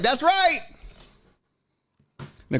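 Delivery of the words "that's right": smug. Retold or imitated. Smug reply to the kid.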